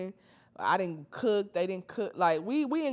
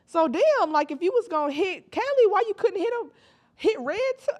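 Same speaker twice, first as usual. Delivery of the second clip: rising mock outrage; 9 semitones higher, 3 dB louder than usual. Real, not acted.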